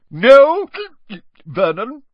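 put-on drunken hiccup on the words. A narrator's reading. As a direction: say it with a boozy hiccup. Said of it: "hiccuped Aunt Marge".

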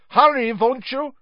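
anxious shout after a friend; calling after. Acted. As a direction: call after him urgently. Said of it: "Krum called after him".

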